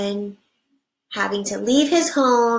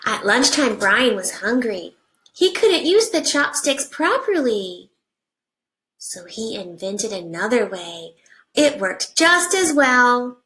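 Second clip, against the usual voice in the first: put-on reading to children. Teacher reading a picture book to her class, animated storybook voice.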